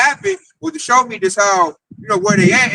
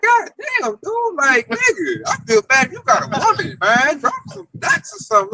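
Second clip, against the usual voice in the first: higher, incredulous scolding; mocking disbelief; 7 semitones higher than usual. Scolding the scared coworker, higher and exasperated. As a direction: tease him in a high, exasperated voice. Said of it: "I'm like".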